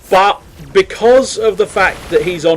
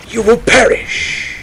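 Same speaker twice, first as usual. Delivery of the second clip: lightly voiced villain threat, higher and slow; mock menace; 7 semitones higher, slower than usual. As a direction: pronounce doom slowly, half-playfully.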